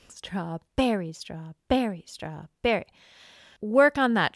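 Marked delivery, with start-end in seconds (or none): 0.1-2.9 "Strawberry" with the "berry" hit hard, chanted softly; punch the berry. Shown as a demonstration. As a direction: stress "berry" in "strawberry" like a bow stroke. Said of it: "Punch the berry".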